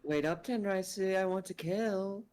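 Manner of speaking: lilting sing-song, a bit unsettling. Acted. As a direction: sing it out sweetly, but menacing. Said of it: "She says in an alarmingly sing-song voice".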